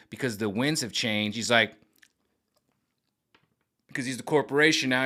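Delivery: ordinary delivery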